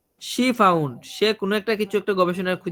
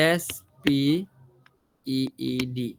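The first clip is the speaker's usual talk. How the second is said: "এস পি ই ই ডি" letter by letter, slow and dropping; spelling out; 5 semitones lower, 3 dB quieter, much slower than usual. Shown as a demonstration. Teacher showing a student the singular spelling, "এস পি ই ই ডি" spelled slowly. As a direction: spell "এস পি ই ই ডি" with gaps between the letters.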